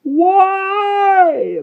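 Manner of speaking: one long, high, anguished roar; drawn-out high howl. A narrator's reading. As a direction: roar it out at full voice. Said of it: "the Dark Angel roared".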